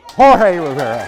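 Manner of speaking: grand ringmaster-style name reveal; announcer intro. Shown as a demonstration. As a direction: announce the name like a host bringing someone on. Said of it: "hold your applause".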